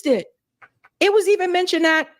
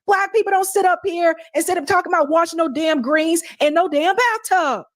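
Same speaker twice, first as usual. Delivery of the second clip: ordinary talk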